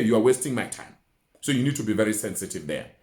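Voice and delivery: normal talking voice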